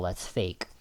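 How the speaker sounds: normal talking voice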